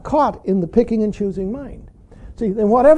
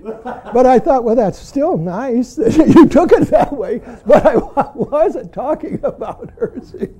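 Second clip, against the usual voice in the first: louder, laughing through the words; real amused laughter mid-sentence; 3 semitones higher, 6 dB louder than usual. Real, not acted. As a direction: barely hold back laughter telling the story. Laughing over own cute story.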